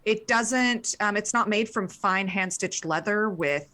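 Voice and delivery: usual speaking voice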